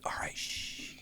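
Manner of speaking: whispered, voiceless "all right, shh"; hushed warning. Retold or imitated. Friend hushing everyone ducked down in the car, whispering. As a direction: shush them in a very soft whisper. Ducking down to hide in story.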